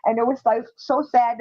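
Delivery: ordinary talk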